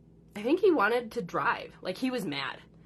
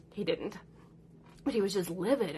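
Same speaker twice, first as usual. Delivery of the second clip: ordinary talk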